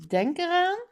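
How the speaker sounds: high-pitched reminder called out; calling after someone. A narrator's reading. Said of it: "roept haar vader haar na". (hoog nageroepen)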